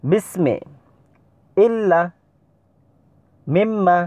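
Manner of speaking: deliberate Arabic recitation drill, syllable by syllable; Arabic letter drill. Shown as a demonstration. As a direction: call out every syllable crisply like a Qur'an teacher. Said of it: "এই যে দেখতে পাচ্ছেন".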